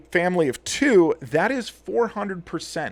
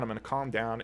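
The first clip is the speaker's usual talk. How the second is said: softer and lower, settling yourself; calming down; 4 semitones lower, 10 dB quieter than usual. Real, not acted. Vlogger calming himself after a rant, quiet. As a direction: breathe it out and cool off, much softer.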